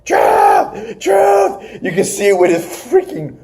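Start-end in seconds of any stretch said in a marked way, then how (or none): 0.0-1.8 high, strained mock scream. Pursuers banging on the restroom door, high mock screams. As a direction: holler the name in a squeezed high voice. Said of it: "he's screaming out".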